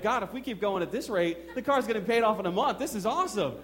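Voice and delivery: giddy excited thanks. Retold or imitated. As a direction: let the excitement bubble up at the good news. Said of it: "I'm really getting excited".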